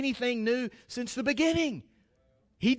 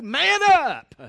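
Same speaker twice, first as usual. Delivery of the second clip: louder, higher, punchy "Man up"; punchy call; 4 semitones higher, 5 dB louder than usual. Pretend. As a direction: bark it out like a coach cheering. Voicing what a man likes hearing.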